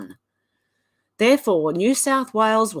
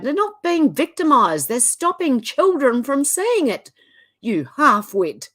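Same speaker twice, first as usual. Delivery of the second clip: heated, rising rebuke, higher and louder at first; real anger; 5 semitones higher, 4 dB louder than usual. Real, not acted. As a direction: tell off the half-wit in genuine anger. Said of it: "I'm sorry, I'm angry".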